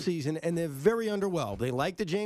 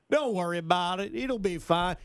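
put-on Texan accent. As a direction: imitate a laid-back Texan. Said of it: "in his Texan accent".

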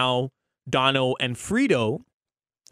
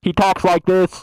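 slow, higher, booming mock mic voice; super loud into cupped mic; 4 semitones higher, 8 dB louder than usual. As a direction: imitate a pest blaring into a cupped microphone. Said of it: "he does it super loud".

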